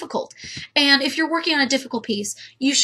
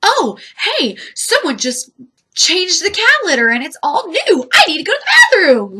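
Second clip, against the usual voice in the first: higher, louder mock cat voice; 5 semitones higher, 4 dB louder than usual. Voicing the cat rushing off to fresh litter, mock eager and quick. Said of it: "It's kinda like".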